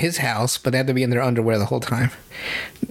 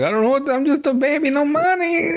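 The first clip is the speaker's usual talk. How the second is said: put-on weird baby voice; 12 semitones higher than usual. Diaper guy pleading to the cleaning girls, squeaky baby whine. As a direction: squeak it out like a helpless toddler. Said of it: "weird baby boy".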